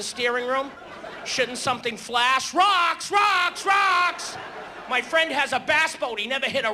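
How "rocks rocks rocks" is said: panicked lookout screaming at the captain; shouted warning. Acted. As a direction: yell it out, loud and high. Comic yelling warning about rocks.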